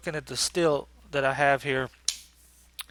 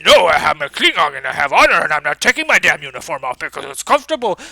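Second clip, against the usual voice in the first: loud, fast, stubborn ranting; mock Klingon rant; 12 dB louder, faster than usual. Worf defending his uniform to Dax, hammed-up loud rant. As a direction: rant proudly about honor.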